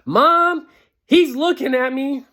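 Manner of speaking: high, loud whine to Mom; whiny tattling little sibling. Retold or imitated. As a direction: tattle like a bratty little sister. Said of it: "my sister was like".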